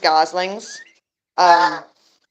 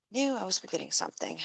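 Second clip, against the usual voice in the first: muttered to self; 14 dB quieter, faster than usual. Real, not acted. Muttering to herself.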